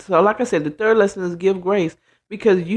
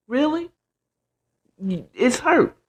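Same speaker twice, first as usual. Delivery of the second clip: usual speaking voice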